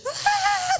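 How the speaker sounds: very high mock scream; imitated scream. Kids screaming at dad's prank, imitated shriek. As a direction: shriek in hammed-up terror. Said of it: "screaming with all of our might".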